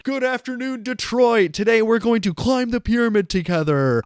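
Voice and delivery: motivational speaker greeting a crowd, pumped up; booming motivational speech. Pretend. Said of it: "I sound like a motivational speaker".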